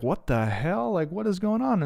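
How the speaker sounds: retold startled what-the-hell, pitched high; reliving the shock of seeing a gnome; bewildered disbelief. To listeners, retelling waking to a gnome, startled high disbelief.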